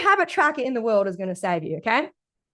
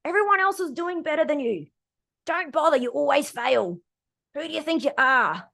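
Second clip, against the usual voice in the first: a touch of a sneering inner critic; mean inner critic voice. Retold or imitated. Coach voicing the inner critic to listeners, mildly mocking. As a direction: lightly voice the nagging mean girl in your head. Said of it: "It's like".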